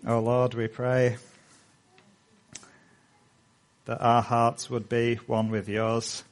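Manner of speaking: soft, unhurried prayer voice; closing prayer. Acted. Pastor leads the congregation in prayer, hushed and reverent. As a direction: pray quietly on behalf of the church.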